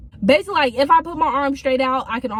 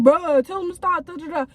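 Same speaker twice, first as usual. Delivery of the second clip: higher, whiny plea to staff; frustrated kid; 7 semitones higher than usual. Retold or imitated. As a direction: complain like an annoyed teen. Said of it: "the kid that he hitting is getting frustrated".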